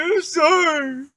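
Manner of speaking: high, loud, drawn-out fake wail; stretched-out whiny mock sob; mock crying. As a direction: imitate a bawling apology. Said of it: "He started crying".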